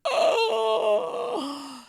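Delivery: high dramatic gasp. Acted. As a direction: gasp in mock horror. Reaction to turkey neck.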